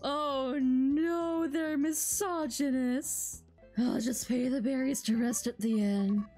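ordinary talk, nothing marked

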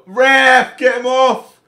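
high, loud demand at the referee; angry yell. Real, not acted. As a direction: bellow at the ref. Yelling at the referee on screen.

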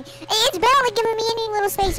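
ordinary talk

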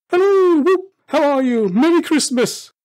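high, loud, whooping holler; exuberant greeting. A narrator's reading. Scrooge greeting the Christmas turkey, exuberant holler. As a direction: hail it with giddy joy, very loud.

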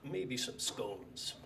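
slow hushed New York baritone imitation; Pete's voice. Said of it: "in that voice of his".

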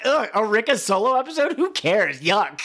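mock disgust, sneering; disgusted dismissal. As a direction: scoff like a bored executive. Mocking dismissive producers.

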